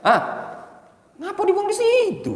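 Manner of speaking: very high squeaky local-accent scolding; Malay-style accent. Shown as a demonstration. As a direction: whine it in a thick regional accent. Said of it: "beda kan logatnya nih". (logat daerah melengking tinggi)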